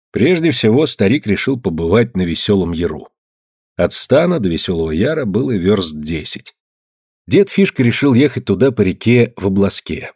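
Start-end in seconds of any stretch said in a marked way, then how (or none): none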